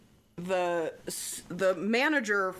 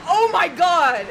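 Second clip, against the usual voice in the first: loud, high "oh my God" of sudden realization; shocked exclamation; 10 semitones higher, 15 dB louder, slower than usual. Retold or imitated. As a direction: blurt it out in shock. Realizing it was Sugar Ray.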